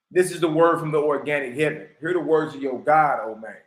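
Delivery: low, measured reading voice; scripture reading. Acted. Reading scripture aloud to viewers, low and measured. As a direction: read it out like holy text. Reads from the Book of Discipline.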